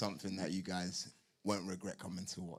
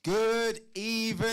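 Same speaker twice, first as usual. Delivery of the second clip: hyped shout; 14 semitones higher, 13 dB louder, slower than usual. Real, not acted. Hyped fighter greeting the crowd.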